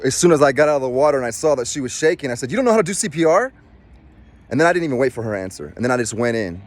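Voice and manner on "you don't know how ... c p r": high, fast mocking question; smart-ass jab. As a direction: needle the shaking lifeguard, quick and cheeky. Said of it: "I'm kind of a smart ass".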